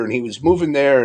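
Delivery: normal talking voice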